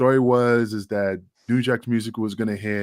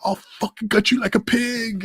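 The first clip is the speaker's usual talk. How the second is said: mock threatening wrestler; 12 semitones higher than usual. Wrestler threatening the speaker in the locker room, shrieking menace. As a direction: shriek the threats like a crazed tough guy. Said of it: "He's like".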